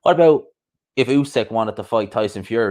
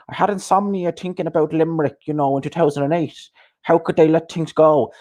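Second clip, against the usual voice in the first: higher, whiny mock lament; lightly voiced moaning pundit; mocking him; 7 semitones higher than usual. Boxing fan parodying a YouTuber he despises, higher whine. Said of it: "then he's talking about".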